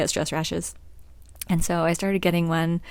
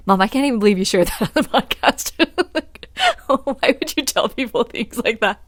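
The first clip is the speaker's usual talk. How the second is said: daughter's disbelief; 3 semitones higher, 5 dB louder than usual. Retold or imitated. Mom quoting her daughter's shock at the podcast, laughing and fast. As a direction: play the daughter, half laughing, half scandalized. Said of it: "she's like".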